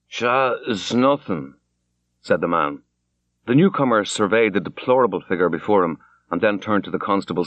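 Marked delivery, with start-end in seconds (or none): 0.1-1.5 put-on drunken slur. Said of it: "He spoke very thickly".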